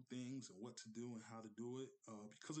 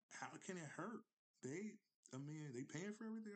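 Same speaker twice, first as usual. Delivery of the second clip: higher, lightly voiced wife, shrugging it off; wife's voice; 6 semitones higher than usual. Wife telling her husband there's no harm, higher voice. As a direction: play the wife, easygoing and pitched up.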